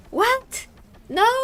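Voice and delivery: high, loud imitation of an outraged ex; retold shocked yelp; mock offended shock. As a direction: squawk it in exaggerated disbelief. Retells offended ex-boyfriend's reply.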